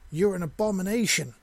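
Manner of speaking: shouted in revulsion; sudden yell. A narrator's reading. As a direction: hurl the insult at him. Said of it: "she suddenly yelled".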